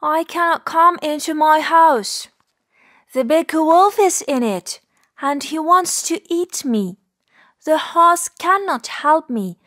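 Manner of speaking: high, plaintive rabbit voice; despairing little rabbit. Acted. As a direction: lament each failed hope as a storybook rabbit.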